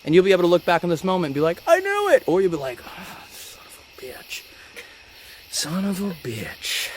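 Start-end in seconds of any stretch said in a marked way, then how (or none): 1.7-2.2 very high-pitched gleeful outburst; acted vindication, pitched way up; mock triumph. Acted triumph of a correct guesser. 2.9-4.4 slow, voiceless whispered curse; drawn-out whispered dismay; mock defeated whisper. Acted regret of a wrong guesser. 5.5-6.9 very slow, hushed but voiced curse; acted dismay, soft and stretched; mock defeated curse. Acted regret, repeated with voice.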